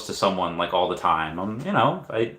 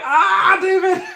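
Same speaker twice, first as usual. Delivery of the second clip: much higher, louder excited squeal with a laugh; fangirl squeal; 6 dB louder, much slower than usual. Pretend. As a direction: gush at meeting your idol. Meeting David, fan excitement.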